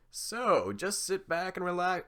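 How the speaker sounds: bright, loud presenter voice; talk show host. Acted. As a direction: sell it like a TV host. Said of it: "sounds like a talk show host".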